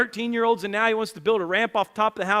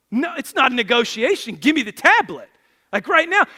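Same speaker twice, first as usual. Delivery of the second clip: acted firm dad, loud and higher; retold stern order; 6 semitones higher, 8 dB louder than usual. Father tells four-year-old to hand over the tablet, stern. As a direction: lay down the law as the father.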